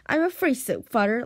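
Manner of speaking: plain reading voice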